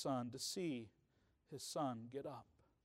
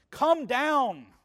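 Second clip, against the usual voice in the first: raised urgent plea, come down; pleading command; 5 semitones higher, 7 dB louder than usual. Retold or imitated. Desperate father calling to Jesus, raised urgent plea. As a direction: call out to Jesus, begging him to come. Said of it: "So he says".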